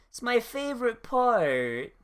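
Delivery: mock exasperated DM. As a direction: whine at the players like a weary game master. Playing the exasperated DM.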